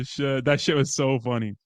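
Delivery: ordinary talk, nothing marked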